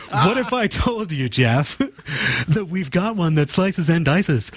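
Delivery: high, hammed-up TV pitchman; infomercial voice. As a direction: sell it like a late-night ad. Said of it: "I have to sound like an infomercial".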